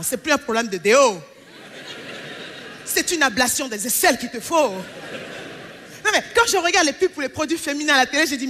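usual speaking voice

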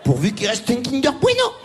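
high, hammed-up fretting over a snack; mock panicked Joey. Comic mimicking the tennis star fretting over his Kinder Bueno. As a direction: fake worry, the hmm drawn out very slowly. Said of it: "il fait".

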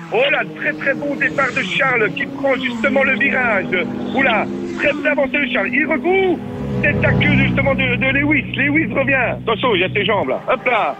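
commentateur sportif qui s'emballe, rythme vif; commentaire de course en direct. Acted. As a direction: fais vivre la course comme à la radio. (commentaire de course animé)

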